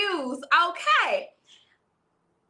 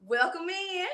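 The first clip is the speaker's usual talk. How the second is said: playful, higher-pitched welcome chant; clowning welcome; 5 semitones higher, slower than usual. Pretend. Host greets viewers on request, clowning higher-pitched welcome. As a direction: draw it out high, hamming it up. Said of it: "give y'all the welcome in".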